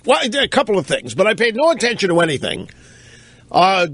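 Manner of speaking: usual speaking voice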